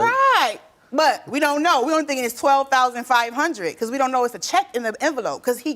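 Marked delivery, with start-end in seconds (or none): 0.0-0.6 emphatic right. Real, not acted. Emphatic agreement to host.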